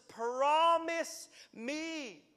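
high, slow, loud pleading; begging cry. Acted. Preacher voicing the woman's plea, slow begging cry. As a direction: stretch it out as a desperate plea. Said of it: "Like, I'm begging".

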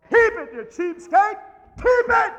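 high, loud scornful yell; God's scolding shout. Retold or imitated. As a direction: shout him off as a cheapskate. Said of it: "God's saying".

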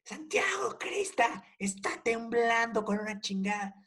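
very high, frantic imitation of mom; panicked mom yell. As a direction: squeal it out in mock panic. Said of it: "empecé a gritar".

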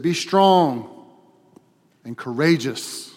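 loud, forceful scripture proclamation; emphatic charge. Real, not acted. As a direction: charge the congregation with full conviction.

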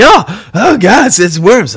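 mock horrified Nick; mock horror. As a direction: gag on discovering worms. Nick discovering worms.

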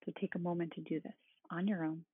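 soothing, unhurried meditation guide; calm meditative tone. Acted. Leads a guided body-scan meditation.

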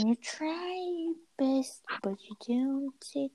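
high, slow, soft dramatic line; mock dramatic quote. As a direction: deliver it like a sad movie quote. Sudden English quote.

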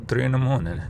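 ordinary delivery

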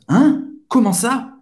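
high, rising questions of surprise; surprised. Shown as a demonstration. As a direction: react in disbelief, pitch jumping up. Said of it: "expressar uma, uma surpresa". (surpreso, perguntando em tom agudo)

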